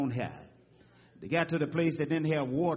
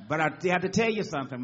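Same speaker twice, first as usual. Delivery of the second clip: ordinary delivery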